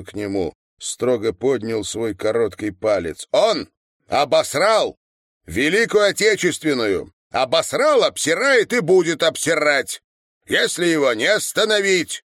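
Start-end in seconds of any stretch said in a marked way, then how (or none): 3.3-12.2 high, loud, emphatic tirade; worked-up, preachy indignation; angry denunciation. A narrator's reading. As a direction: hammer the accusation home. Lectures lad sternly, angrily.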